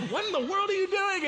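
high-pitched incredulous outburst; retold exasperated yell. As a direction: cry out in mock disbelief. Retold yelling at panicked brother-in-law.

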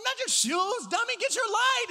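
high, loud exasperated yell; frantic shout. Retold or imitated. Pastor yelling at brother-in-law to grab the light.

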